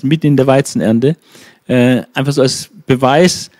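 normal talking voice

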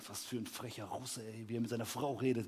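low, hushed bystander grumble; empörtes Tuscheln. Retold or imitated. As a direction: mutter indignantly under your breath. Shocked passengers muttering about him. (leise empörtes Gemurmel)

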